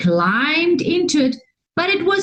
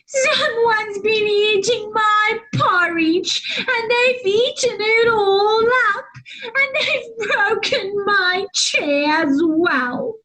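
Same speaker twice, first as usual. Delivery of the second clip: higher, mock-tearful little bear; squeaky sobbing Baby Bear; 6 semitones higher than usual. A narrator's reading. Baby Bear tells of his broken chair, mock sobbing. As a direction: whimper it like a small cub. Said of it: "he sobbed in his little squeaky, teeny".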